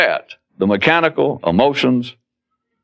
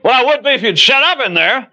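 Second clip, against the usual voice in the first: very high, exasperated holler; irritated hollering; 14 semitones higher than usual. Acted. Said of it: "You're hollering".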